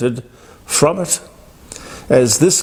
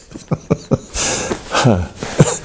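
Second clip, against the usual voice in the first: low chuckle at someone's expense; short run of mocking chuckles; scoffing laugh; 4 semitones lower than usual. Real, not acted. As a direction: laugh it off in disbelief. Laughs after mocking her outburst.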